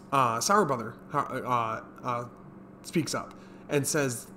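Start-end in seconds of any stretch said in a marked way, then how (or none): none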